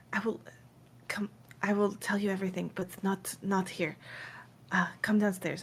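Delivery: lightly voiced soft, halting promise; a hint of hushed urgency; cautious Fawn promising. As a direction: say it quietly, guarding the secret. Game master voices Fawn promising to explain.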